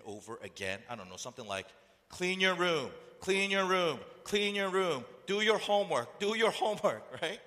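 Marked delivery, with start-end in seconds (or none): 2.1-7.0 loud, raised nagging parent voice. Acted. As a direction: nag a child over and over. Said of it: "did your parents always tell you".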